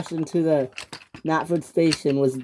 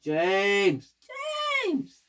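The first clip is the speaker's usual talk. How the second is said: shrieking; 12 semitones higher, much slower than usual. A narrator's reading. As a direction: squeal the name as a chorus of complaint. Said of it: "they shrieked".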